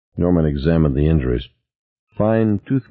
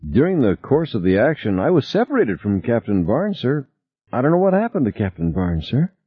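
mildly formal report, pitched higher; 6 semitones higher than usual. A narrator's reading. Tina reporting on Captain Barnes to Norman, mildly formal. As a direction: report to a superior with a touch of stiffness. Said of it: "Tina spoke formally, her face a mask".

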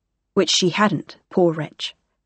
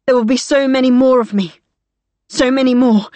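loud, fervent vow; defiant; 4 semitones higher, 5 dB louder than usual. Acted. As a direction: declare it with conviction.

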